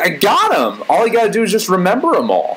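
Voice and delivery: put-on high, confident little-kid brag; excited four-year-old. Kindergartner sure that numbers are conquered, high and cocky. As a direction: play a child sure it's easy. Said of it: "being a four-year-old kid in kindergarten".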